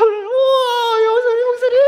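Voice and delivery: hammed-up squeal, very high and loud; overjoyed greeting. Newcomer greeting the pastor after church, exaggerated delight. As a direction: imitate gushing delight with a long drawn-out wail. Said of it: "얼마나 반가워하시는지".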